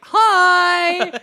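very high, loud squeal; gushing teenage greeting. Acted. Younger Voldemort popping up in the chamber, gushing teenage greeting. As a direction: sing out an over-eager hello. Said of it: "you would talk like that in high school".

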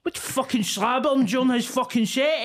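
high, heated rant at the heckler's wife; mock fury. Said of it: "And then I was like".

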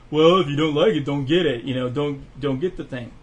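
mock voice of dismissive customers; dismissive naysayers. Mimicking people who shrug off the Netflix price hike, dismissive. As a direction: put on a brusque know-it-all customer. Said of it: "There are people like".

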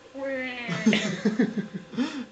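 long, high-pitched fake bawl; mock crying wail. Grown man playing a ten-year-old for an interviewer, mock crying wail. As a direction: wail like a little kid. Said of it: "Why are you crying?".

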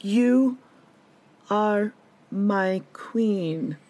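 very slow mock-tender "you are my queen"; drawn-out declaration. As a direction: stretch each word like a lovesick suitor. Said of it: "He's like".

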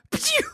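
very high pew zoom noise; speed zoom sound. Pretend.

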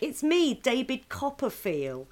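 high, slowing showy announcement; mock cheeky introduction. Imitating old politician grabbing women, mock cheeky introduction. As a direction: announce yourself as a jokey old groper. Retold groping politician's catchphrase.

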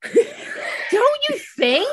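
high, drawn-out squeal through laughter; laughing. Real, not acted. Asking co-host to agree the show is pornographic, laughing. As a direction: crack up while pleading for agreement, pitch way up.

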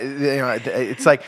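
usual speaking voice

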